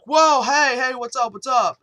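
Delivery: loud, high, alarmed "whoa, hey"; startled greeting. Retold or imitated. Host to police with guns drawn at the door, startled.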